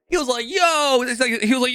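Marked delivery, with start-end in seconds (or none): 0.5-1.0 amazed mover. Retold or imitated. Mahmoud's amazed reaction retold.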